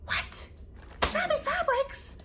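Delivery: very high, slow squeak of disbelief. Retold or imitated. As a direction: squeak it out, baffled. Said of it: "I'm like".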